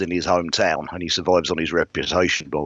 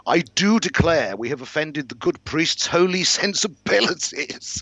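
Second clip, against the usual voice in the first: very high, slow, affected lordly mockery; theatrical scoffing aristocrat; mocking lord; 12 semitones higher than usual. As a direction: feign offence in a drawn-out high voice. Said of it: "he laughed far too loudly".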